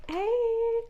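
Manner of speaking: high, drawn-out playful hey; playfully overdone hello; chummy greeting. Pretend. Imagined friendly greeting after switching.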